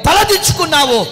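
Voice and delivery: loud, building proclamation; preaching climax. Real, not acted. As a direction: swell to a loud promise. (గట్టిగా పెరుగుతున్న ప్రకటన)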